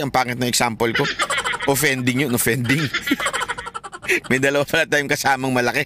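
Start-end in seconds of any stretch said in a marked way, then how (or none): 2.9-4.2 high embarrassed laugh; real sheepish laugh. Real, not acted. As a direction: laugh at your own slip. Laughing at own gaffe.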